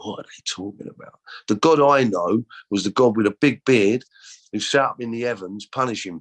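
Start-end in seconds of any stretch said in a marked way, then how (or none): 0.0-1.2 whispered doubt. Retold or imitated. Inner doubt about revival talk.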